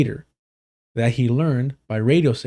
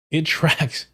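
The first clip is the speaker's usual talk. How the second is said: laughing through the repeated word; amused laugh breaking up tracks; 4 semitones higher than usual. Real, not acted. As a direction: laugh it out mid-line.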